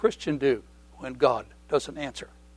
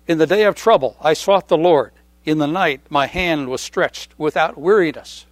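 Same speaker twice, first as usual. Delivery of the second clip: scripture reading; 6 dB louder than usual. Acted. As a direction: read the psalm with weight. Reads psalm aloud.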